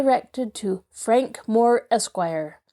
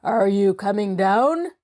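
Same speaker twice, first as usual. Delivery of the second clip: a stern father calling up the stairs. A narrator's reading. Father summoning the girls from below, stern. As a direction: call down like a stern Bluebeard. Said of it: "a stern voice from below called".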